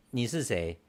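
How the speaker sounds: usual speaking voice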